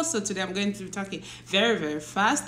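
words tumbling out quickly; speed-talk through it to tease Josie; talking very fast. Real, not acted. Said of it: "I'm gonna be talking very fast".

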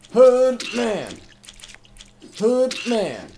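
loud, high call with falling tail; big drawn-out game holler; playful shout. Real, not acted. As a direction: holler it out high, letting it drop.

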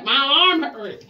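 normal talking voice

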